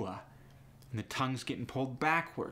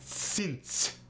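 higher-pitched "Since", light Russian accent; "Since" with little glide, Russian-accented; lighter Russian accent; 6 semitones higher than usual. Shown as a demonstration. [name] showing accent thickness, lighter Russian accent. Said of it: "It depends on the thickness".

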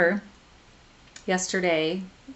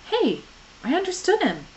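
surprised; 12 semitones higher, 5 dB louder than usual. Acted. Alcatraz suddenly understands Quentin.

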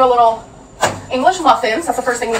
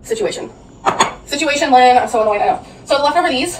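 ordinary talk

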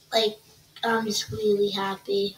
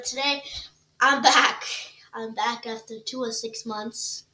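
ordinary talk

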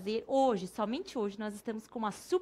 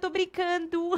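usual speaking voice

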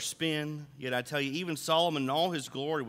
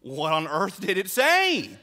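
slow, loud fake amazement, drawn out; mock surprise; 3 semitones higher, 6 dB louder, slower than usual. Husband feigning shock at wife's news, loud and slow. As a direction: play dumb with a big hammed-up gasp of interest.

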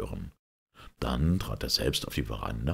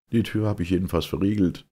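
out of breath; 5 semitones higher, 5 dB louder, faster than usual. A narrator's reading. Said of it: "etwas außer Atem gekommen".

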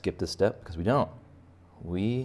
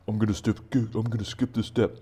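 lazy student; 3 semitones higher than usual. Retold or imitated. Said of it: "Don't skip this step".

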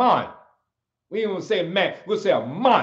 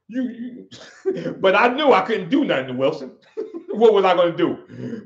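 laughing. Real, not acted. Laughing at mentor memory.

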